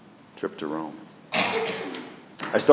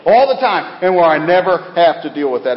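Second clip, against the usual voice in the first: high, loud mock insistence; imitated stubborn refusal; 7 semitones higher, 6 dB louder than usual. Preacher voicing people who avoid difficult journeys, mock stubborn refusal. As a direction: dig in, voice climbing and louder. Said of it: "some people are of the mindset".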